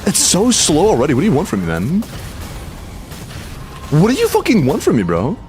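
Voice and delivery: high-pitched exasperated protest, dropping at the end; real frustration. Real, not acted. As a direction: plead in exasperation, pitch swinging up. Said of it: "I'm getting frustrated".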